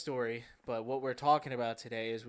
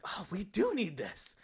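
higher, drawn-out revelation; mock realization; 6 semitones higher, slower than usual. Retelling how the pitch won him over, mock realization. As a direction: play the easily convinced customer. Acts out himself being persuaded.

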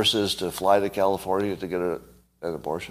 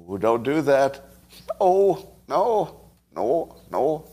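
higher, badly faked Canadian accent; 4 semitones higher, slower than usual. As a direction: have a clumsy go at sounding Canadian. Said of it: "I can't do a Canadian accent".

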